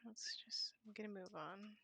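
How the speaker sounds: fading out from tiredness, barely there; soft, spent whisper of someone about to collapse; weak whisper. Acted. Voicing an exhausted, fading Rena.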